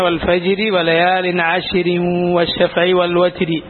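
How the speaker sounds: slow connected tajweed reading; slow linked Quran reading; joined recitation. Shown as a demonstration. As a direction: read it through, linking each word. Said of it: "കൂട്ടിയതും അങ്ങനെ".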